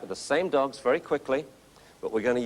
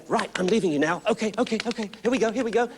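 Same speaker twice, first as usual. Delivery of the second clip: higher, bouncy puppy-luring voice; excited coaxing; 7 semitones higher, faster than usual. Shown as a demonstration. Coach showing how to leave the puppy excitedly, higher. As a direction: chirp it up to make the puppy follow. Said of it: "You've got to say".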